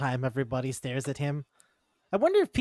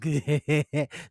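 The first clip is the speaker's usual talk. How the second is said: creepy laugh; 4 dB louder than usual. Retold or imitated. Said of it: "and she goes like".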